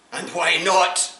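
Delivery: slow, loud, high challenge; sergeant demand. Acted. Sergeant presses the soldier for an answer, slow and loud. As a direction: drag out the demand.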